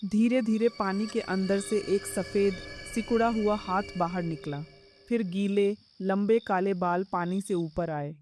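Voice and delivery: plain reading voice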